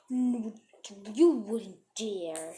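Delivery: slow, drawn-out challenge after a gasp; acted indignant dare. Mean girl to the narrator, acted indignant dare. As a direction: stretch it out in mock disbelief.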